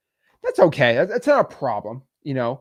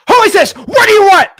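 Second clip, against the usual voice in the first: much higher and louder, barked demand; aggressive shout; 13 dB louder than usual. Retold or imitated. Annoyed seller answering a cold call with a loud, much higher shout. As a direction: yell it like an annoyed homeowner. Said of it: "It's always".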